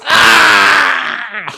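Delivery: short hammed-up cry of pain as if shocked; victim's mock yelp at the shock; mock pained yell. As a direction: yelp like you just got zapped. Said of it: "the guy on the other side goes".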